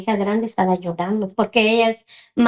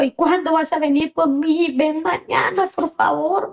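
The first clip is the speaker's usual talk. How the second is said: high, plaintive begging; pleading child; 7 semitones higher than usual. Retold or imitated. Daughter begging her mother to fetch her, pleading. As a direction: imitate a child imploring a parent.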